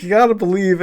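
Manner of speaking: Bill Burr fan voice. Retold or imitated. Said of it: "And he's like".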